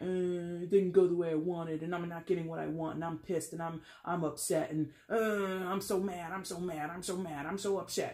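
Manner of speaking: mock whiny complainer, dragged groans and repeats. Reader mimicking a disappointed complainer, whiny. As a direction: whine it out as a running gripe. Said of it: "you know, whiny about it".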